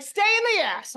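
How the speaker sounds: insist, raising the voice; upbeat emphatic push; emphatic urging. Retold or imitated. Urging mom to stay positive.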